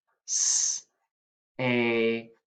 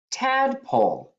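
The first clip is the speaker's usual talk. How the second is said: high announced word; 12 semitones higher than usual. Shown as a demonstration. Teacher announcing the next spelling word high. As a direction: call out tadpole high. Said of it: "Number four".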